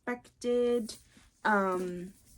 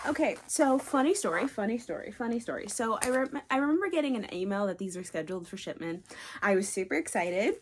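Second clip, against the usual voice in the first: ordinary delivery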